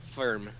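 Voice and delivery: "Firm" with the vowel of "fur"; mimicked pronunciation. Asking how his word is spelt, low mimicked "Firm". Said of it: "how do you spell this?".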